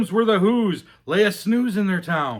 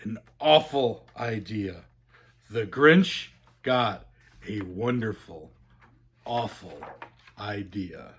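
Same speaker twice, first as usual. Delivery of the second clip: lower, softer sly relish; sinister relish; 8 semitones lower, 6 dB quieter than usual. A narrator's reading. Narrator savoring the Grinch's scheme, low and sly. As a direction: savor the awful idea, low.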